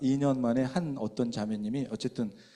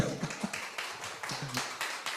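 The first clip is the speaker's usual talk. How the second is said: breathy laugh; 11 dB quieter than usual. Real, not acted. Laughter after asking applause.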